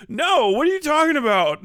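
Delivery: high, indignant pushback; incredulous refusal. Acted. Actor from that area turning down the part, indignant. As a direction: refuse as if the offer is absurd, pitched high.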